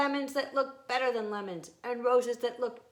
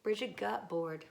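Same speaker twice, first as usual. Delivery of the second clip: usual speaking voice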